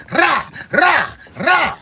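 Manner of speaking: high barked "rah" gunfire noises; mock gunfire; gunshot sounds. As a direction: mimic a burst of shots with the voice. Imitating gunshots.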